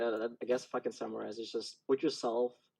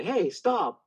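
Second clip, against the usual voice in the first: raised, higher-pitched warning shout; loud call; 8 semitones higher, 5 dB louder than usual. Shown as a demonstration. Self-defense student calling out to stop an attacker, loud. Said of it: "and call it loud".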